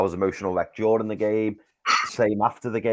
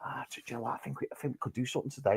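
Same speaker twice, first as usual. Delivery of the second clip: hushed hope; 2 semitones higher, 11 dB quieter, faster than usual. Retold or imitated. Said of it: "I go".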